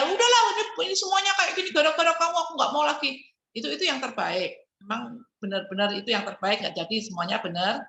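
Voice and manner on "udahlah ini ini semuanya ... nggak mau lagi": high, fast fed-up blaming; exasperated outburst. Shown as a demonstration. Partner demonstrating a fed-up outburst, high and fast. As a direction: throw up your hands, voice pitched up. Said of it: "misalnya dia".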